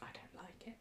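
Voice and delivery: unvoiced, quiet whisper. Real, not acted. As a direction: breathe it as a private aside.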